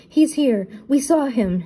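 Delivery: high, loud excited cry; excited exclaim. A narrator's reading. Shaggy bursting in to tell the gang, excited. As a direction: blurt it out, thrilled and scared. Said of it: "Shaggy exclaimed".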